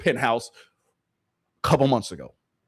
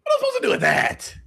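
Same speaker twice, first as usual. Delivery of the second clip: mock Tatum. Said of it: "Jason Tatum, like, looking like".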